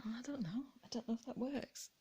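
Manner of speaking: unsure breathy whisper to herself. Real, not acted. Whispering to herself while arranging decor, unsure. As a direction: hush it as you weigh up the vase.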